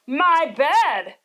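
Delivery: Papa Bear seeing his bed, sputtering loudly. A narrator's reading. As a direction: splutter it out in shock. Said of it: "Papa Bear sputtered".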